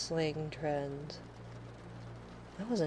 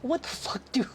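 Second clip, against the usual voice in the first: higher, breaking into laughter; laughing disbelief; 7 semitones higher than usual. Retold or imitated. Streamer reacting to her kid's slang, laughing. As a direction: gasp it through a laugh. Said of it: "It's like".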